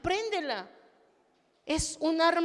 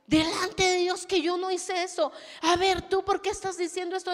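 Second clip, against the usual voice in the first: indignant defence; 6 semitones higher, 4 dB louder than usual. Retold or imitated. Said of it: "me paré al frente y les dije".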